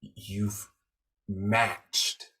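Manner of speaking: measured phrases with long, weighty pauses; slow, deliberate pronouncement. Retold or imitated. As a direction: pronounce each observation like a verdict. Retold solemn stranger sizing her up.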